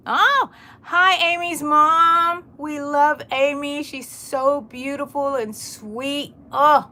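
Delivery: loud, high, drawn-out excited hello; gushing greeting. Real, not acted. Gushing greeting to viewer's mother.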